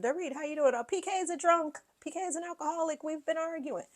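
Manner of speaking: high, catty mimic of a castmate spilling drama; mock gossip voice. Imitating a castmate telling Dorit her husband is a drunk.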